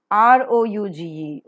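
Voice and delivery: ordinary delivery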